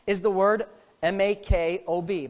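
usual speaking voice

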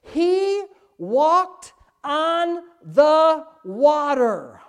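"he walked on the water" slow, high and loud, word by word; heavy emphasis. Shown as a demonstration. Preacher drives home Peter's miracle, "he walked on the water" slowly hammered out. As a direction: punch each word of "he walked on the water" with space between. Notice this.

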